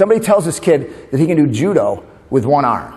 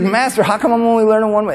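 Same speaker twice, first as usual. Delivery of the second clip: put-on eager kid; 8 semitones higher than usual. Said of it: "And he's like".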